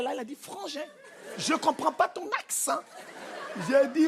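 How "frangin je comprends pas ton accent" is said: higher, softer, affected imitation; mock integrated immigrant. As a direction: lightly put on an assimilated man's voice. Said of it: "c'est qu'il est intégré". (imitation maniérée du frère intégré)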